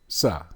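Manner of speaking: "Sa" with a plain S, low and soft; "Sa" with s, not sh; S replacing sh. Shown as a demonstration. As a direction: sound out "Sa" as one low syllable. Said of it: "replaced it with an S".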